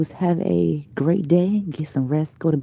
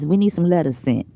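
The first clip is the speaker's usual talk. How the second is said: ordinary talk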